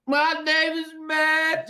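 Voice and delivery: slow, very high, loud lyric imitation; mock song vocal. Bandmates blurting lyrics at each other, loud high mock vocal. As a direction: belt the line high and drawn-out. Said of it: "me and Ben will be just like".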